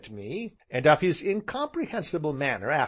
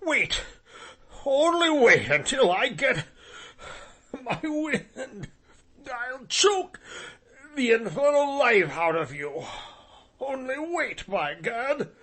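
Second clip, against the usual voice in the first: high, winded angry bluster; breathless threat; 12 semitones higher than usual. Acted. Winded gentleman threatening the narrator, furious. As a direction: choke out the threat while still winded.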